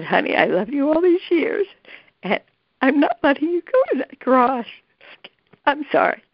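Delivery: high, tearful and choked up; fighting back tears while retelling it; real tears breaking through the quote. Real, not acted. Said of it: "oh, I'm gonna cry".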